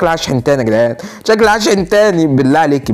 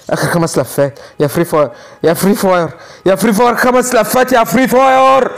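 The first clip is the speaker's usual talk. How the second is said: escalating theatrical appeal; building mock plea; 4 semitones higher than usual. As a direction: beg the game with rising desperation. Mock pleading with game, building.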